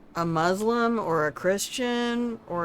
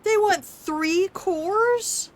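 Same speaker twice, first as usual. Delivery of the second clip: much higher, very slow incredulous question; drawn-out disbelief; 11 semitones higher, much slower than usual. Real, not acted. Gamer repeating the cost in slow disbelief. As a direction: stretch every word in disbelief.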